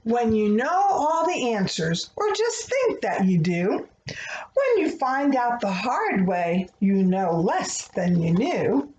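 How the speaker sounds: gentle, rhythmic picture-book reading; reading to children. Acted. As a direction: read the rhyming prayer like a bedtime story. Reads a rhyming prayer book aloud.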